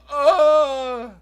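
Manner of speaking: high, loud anguished cry; exasperated cry. Retold or imitated. Mom imitating her own frustrated cry at the tantrum. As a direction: howl in frustration, hammed-up. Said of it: "And I'm like".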